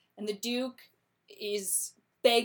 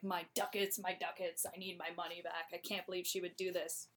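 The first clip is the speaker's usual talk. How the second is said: upset; 2 semitones lower, 9 dB quieter than usual. Retold or imitated. Shylock lamenting lost money.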